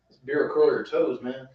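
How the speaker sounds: ordinary delivery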